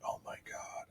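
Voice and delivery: hushed breathless whisper; whispered dismay. Retold or imitated. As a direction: whisper it in horror. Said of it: "I was like".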